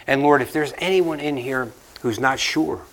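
a pastor praying for the congregation; closing prayer. Acted.

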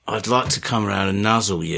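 low, softened creepy come-on; mock sex talk. Host sweet-talking podcast supporters, mock sex talk. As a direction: murmur it as a joke seduction. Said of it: "keep my sex talk".

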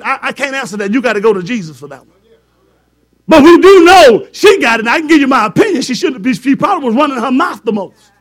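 ordinary delivery

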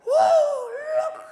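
very high, loud whoop of discovery; hammed-up astonished cry; mock amazed shout. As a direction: shriek it as if spotting a wonder. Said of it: "there's one that he goes".

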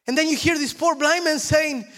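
very high, loud, fervent storytelling sermon; preaching at full force. Real, not acted. Preacher acts out Jesus and Mary for the church, high and loud. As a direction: act out the gospel scene at full force.

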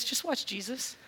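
pointing the listener to Jesus, hushed; soft. Acted. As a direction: let Just watch Jesus fall to a hush. Repeated call to watch Jesus.

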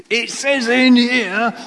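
loud, high, drawn-out hammering; hammed-up Bible-bashing, slow and forceful; mock browbeating. Preacher acting out using the Bible as a club, mock browbeating.

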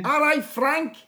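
high-pitched Scouse greeting; Scouse accent. Pretend. Showing off a Scouse accent to the lecturer, high-pitched Scouse. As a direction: put on a high, playful Scouse voice. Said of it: "how to do a Scouse accent".

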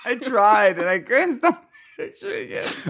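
high voice breaking into giggles; stifled giggles. Real, not acted. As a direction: fight back the giggles. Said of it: "trying not to laugh".

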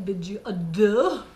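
mock French accent on a farewell; français pour rire. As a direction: say it in joke French, half laughing. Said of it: "That was French".

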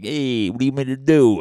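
high, loud put-on whiny protest; a loud, indignant slacker voice; mock defensive excuse. Comic voicing a slacker blaming the pandemic, loud mock whine.

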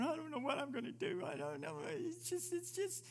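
high whimpering mock despair; mock crying. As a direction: whine it like someone crying helplessly. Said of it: "Don't sit there and start crying".

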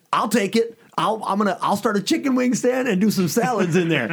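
high, eager mom voice, laughing; mom jumping in. Retold or imitated. Mom telling her sons she'll take the building, high and eager. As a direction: mom eagerly grabbing the deal, high and excited. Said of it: "She says".

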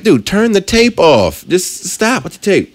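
high, slow drawn-out pleading; stretched-out mock exasperation; exasperated plea. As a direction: beg the screen character to quit it. Pleading with the movie character.

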